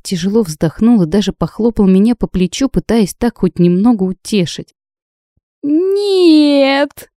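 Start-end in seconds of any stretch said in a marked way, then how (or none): 5.6-7.1 a much higher wailed no; sobbing. A narrator's reading. A girl who can no longer hold back tears wails to her friend. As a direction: let the sob burst out. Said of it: "снова прорыдала я".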